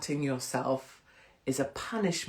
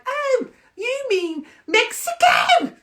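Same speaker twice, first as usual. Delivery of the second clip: high, slow, shouted mock accent; hammed-up accent, squawked with sudden realisation; James English impression; 11 dB louder, slower than usual. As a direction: bellow it in an exaggerated strong accent. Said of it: "his accent is strong".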